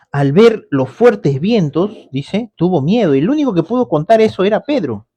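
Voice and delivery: usual speaking voice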